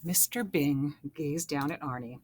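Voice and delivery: usual speaking voice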